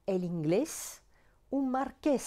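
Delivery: inglés and marqués stressed on the final és; end-stressed "inglés", "marqués"; stress on last syllable. Shown as a demonstration. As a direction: hit GLÉS in inglés and QUÉS in marqués. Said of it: "l'accent tonique est sur la dernière syllabe".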